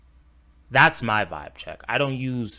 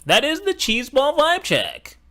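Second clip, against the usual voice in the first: much higher, sing-song sneer at the phrase; mocking impression; 4 dB louder than usual. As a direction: ham up the cringe line in a high mocking voice. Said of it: "that sentence".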